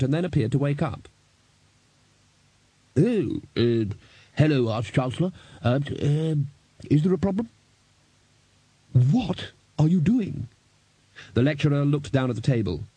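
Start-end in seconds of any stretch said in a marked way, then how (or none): 3.0-7.5 hesitant, dazed greeting full of pauses; flustered and halting; sheepish lecturer. A narrator's reading. Dazed lecturer caught out by boss. 8.9-10.5 slow, higher, heavily spaced question; incredulous boss confronting an underling; exasperated demand. A narrator's reading.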